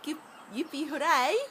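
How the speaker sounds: ordinary talk, nothing marked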